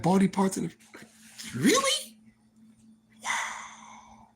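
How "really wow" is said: high stunned squeak; disbelief. Retold or imitated. Stunned by the freezer story, high squeaky disbelief. Reacting to body parts.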